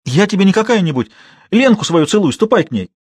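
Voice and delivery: high, loud, shaking words; trembling outburst. A narrator's reading. Girl shaking with anger, forcing out words at the man. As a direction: force it out through trembling. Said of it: "с усилием выговаривала Манька, вся трясясь".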